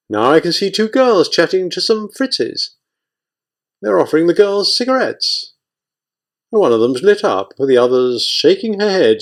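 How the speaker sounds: high lilting running commentary; sing-song report. Acted. Lookout chats to his lieutenant in a high sing-song. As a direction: keep the sing-song going as he watches. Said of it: "report in a sing-song voice".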